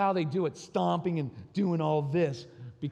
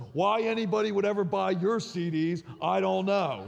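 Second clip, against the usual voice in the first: higher, louder, mocking insult; snide kid jab; 5 semitones higher, 5 dB louder than usual. Son mocking his dad's CDs, snide. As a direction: imitate the son's cheap shot. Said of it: "you know what his fallback was?".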